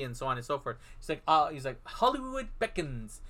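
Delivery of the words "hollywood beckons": slow, higher mock-grand declaration; hammed-up actor. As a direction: stretch it out like a self-important actor.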